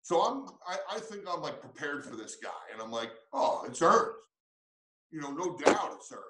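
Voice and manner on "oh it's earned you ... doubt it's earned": raised, punchy certainty in a retold reply; emphatic. Coach recalling his confident answer to the Ranger, emphatic. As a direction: answer firmly, retelling your own conviction. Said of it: "and I'm emphatic".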